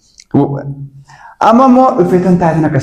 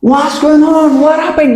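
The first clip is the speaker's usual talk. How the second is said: surprised; 13 semitones higher, much slower than usual. Acted. Acting surprised on the call.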